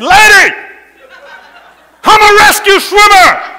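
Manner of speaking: loud, high panicked yell. Retold or imitated. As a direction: shout it up at her over the water. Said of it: "I yelled at her like this".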